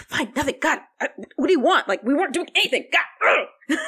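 put-on high, loud bratty teen snapping back; a huffy teenager yelling at her sister; teen outburst. Teen girl yelling back at her older sister, bratty and loud.

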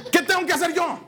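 hammed-up furious tirade; Moses ranting. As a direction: give them the scolding of their lives. Moses rants at people.

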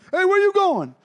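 high, loud call after him; mechanic calls out. Pretend. The mechanic shouting after Riggs as he walks off, high and loud. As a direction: yell after someone walking off.

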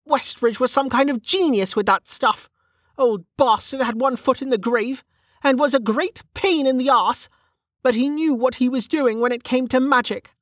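high-pitched frustrated character voice; Velika, frustrated. Acted.

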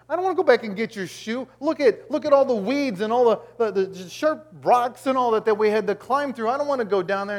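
high, loud, whining refusal; exasperated protest. Acted. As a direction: balk at the errand, voice pushed up in complaint. Acts husband balking at errand.

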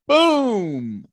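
high, loud, falling boom; imitated gunshot boom. Hunter imitates his friend's second shot, booming. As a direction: sound out a shot echoing away.

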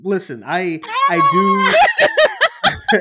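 words squeezed out through a big laugh, pitch cracking up high; can barely speak for laughing; crying with laughter; laughing hard. Real, not acted. Laughing fit while talking.

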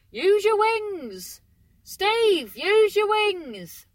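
high, loud and slow, willing the bird to fly; urging shout to a bird. Real, not acted. Coaxing a stranded bird named Steve to fly, slow high calls. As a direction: stretch every word, begging it to flap.

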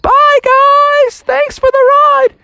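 high, loud cheery holler; farewell shout. Acted. Riders wave to the ostriches, high shouted goodbye. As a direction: call out thanks from far off.

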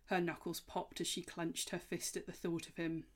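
ordinary delivery

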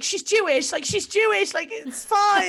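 loud, high, frantic pleading; hammed-up panic, insisting at the top of the voice; retold freak-out. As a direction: reenact a desperate, babbling reassurance. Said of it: "I started freaking out".

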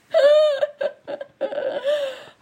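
very high, squealing laugh; real laughing fit tailing into a wheeze; helpless laughing. Real, not acted. Storyteller cracking up over her hallway scene, laughing.

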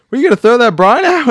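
high, loud quoted outburst; mock yell. Host quotes Homer at Moe's to a friend, mock yell. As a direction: blurt it loud and high, imitating.

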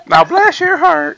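put-on slow, high Southern accent. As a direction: drawl it like a Southern belle. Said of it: "she's not Southern!".

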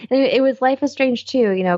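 ordinary talk, nothing marked

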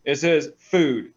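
It says food, slowly, with "food" landing hard; slow stress on food. Real, not acted. Correcting the listener, slowly stressing "food". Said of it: "It does not say gasoline".

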